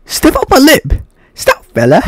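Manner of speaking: high-pitched mock British accent. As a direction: imitate the musical's singers with a British accent. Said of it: "like, a British accent or something".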